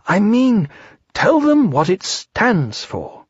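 high, drawn-out bear voice; expectant. A narrator's reading. Pooh prodding the narrator to explain, high slow expectant voice. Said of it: "said Pooh expectantly".

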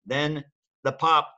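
plain reading voice

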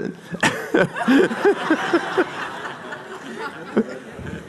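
high airless burst of laughter; real breathless laugh. Real, not acted. Preacher cracks up at Martin's sign name, breathless laughter. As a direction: laugh helplessly at the joke.

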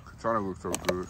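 ordinary delivery

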